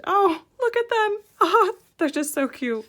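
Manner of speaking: high, loud burst of delight; excited squeal. Real, not acted. Vlogger stumbling on adorable baby characters, squealing with delight. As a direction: gush as the voice shoots up, then eases. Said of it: "my voice goes up a couple octaves".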